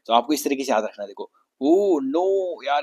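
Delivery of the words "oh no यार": higher, louder mock dismay; mock exasperated oh no. Teacher acting tired of a repeated line, mock dismay. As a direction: groan it in fake despair. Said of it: "ये फिर वही dialogue बोलेगा".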